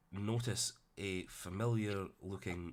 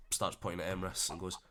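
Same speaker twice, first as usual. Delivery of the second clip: normal talking voice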